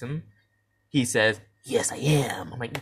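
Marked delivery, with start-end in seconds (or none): 1.6-2.5 unvoiced, eerie whisper. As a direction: hiss the answer with no voice. Vampire answers in the dream.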